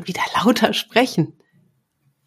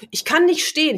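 higher, lightly whiny complaint; whiny participant; 9 semitones higher than usual. Retold or imitated. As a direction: act out a half-hearted whine. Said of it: "Also so".

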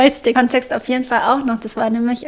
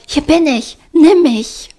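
higher, louder calling out; shouting; 6 semitones higher, 5 dB louder, slower than usual. Retold or imitated. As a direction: call it out to be noticed. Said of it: "was so laut schreit".

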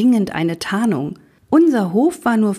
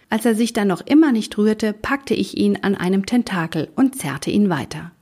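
plain reading voice

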